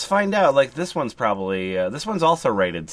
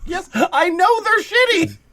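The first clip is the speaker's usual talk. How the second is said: squeaky high shop owner voice; high whiny shopkeeper; 6 dB louder than usual. Acted. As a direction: pitch way up, whine about the reviews.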